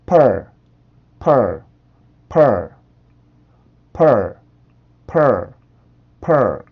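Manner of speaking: "pearl" with a dropped jaw, said like 어 plus a curled R; pearl demo. Shown as a demonstration. Teacher modeling "pearl" for learners, with a clear falling tune. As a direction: repeat "pearl" slowly and clearly, falling each time. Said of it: "자, 해볼게요".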